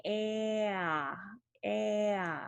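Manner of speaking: "A" as a drawn-out "aah" from apple; short A. Shown as a demonstration. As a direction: glide "A" open as the tongue drops, twice. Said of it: "then drop your tongue".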